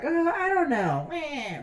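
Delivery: high, drawn-out imitation of a careless doctor; mock dismissive doctor. Patient mocking his doctor, high dismissive voice. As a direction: mimic a doctor who can't be bothered.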